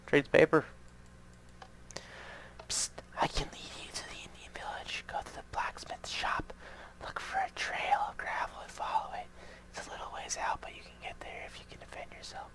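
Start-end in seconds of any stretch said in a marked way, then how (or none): 2.7-12.5 soft, unvoiced secret-agent whisper. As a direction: breathe the tip like a spy passing secrets. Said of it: "We're in a whisper voice now".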